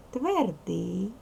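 usual speaking voice

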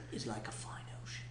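hushed aside; whispering. Acted. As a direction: drop to a breathy whisper. Mock profound whispered aside.

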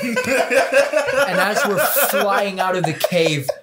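usual speaking voice

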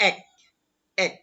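এক said as a short, separate "ek"; closed-vowel pronunciation. Shown as a demonstration. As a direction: model এক with a closed e. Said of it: "সংবৃত উচ্চারণ".

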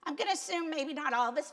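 ordinary delivery

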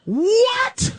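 mock shock.

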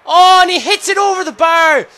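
high, loud disbelief; real frustrated scream; agonised shout. Real, not acted. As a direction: yell as the shot sails over. Shot goes over.

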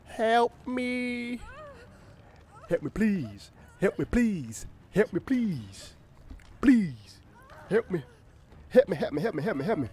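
high, hammed-up cries for help; mock pleading. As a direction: beg your friends, overacted. Hooked, begging teammates.

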